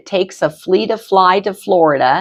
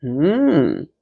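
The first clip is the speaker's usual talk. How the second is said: usual speaking voice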